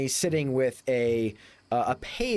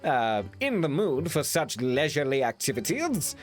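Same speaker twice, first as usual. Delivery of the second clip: lofty gentleman dismissing the idea; hammed-up pompous Englishman; Matt Berry impression; 2 semitones higher than usual. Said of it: "doing my Matt Berry impression".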